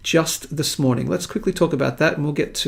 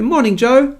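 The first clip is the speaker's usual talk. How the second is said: ordinary delivery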